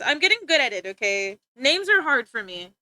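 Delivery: ordinary talk, nothing marked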